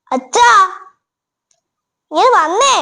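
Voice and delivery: അച്ഛാ called again with a rising end; urgent call. Acted. Calling father again, more urgent.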